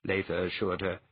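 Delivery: usual speaking voice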